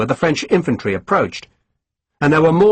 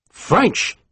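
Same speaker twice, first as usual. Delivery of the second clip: higher, a questioning note of disgust; disgusted question at the news; 7 semitones higher than usual. Acted. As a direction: spit out the word in distaste. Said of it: "Louis sounded disgusted".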